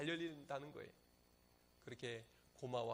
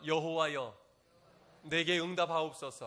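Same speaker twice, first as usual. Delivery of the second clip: louder, measured call to repeat; leading the congregation; 9 dB louder than usual. Shown as a demonstration. Pastor leading the congregation in Elijah's prayer, raised voice. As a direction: call it out for them to follow. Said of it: "한번 따라해 보시겠습니까".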